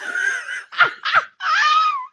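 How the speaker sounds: high, loud shrieking laugh; helpless laughing fit. Real, not acted. Retelling his question to her, collapsing into laughter. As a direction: dissolve into cackles. Cracking up again.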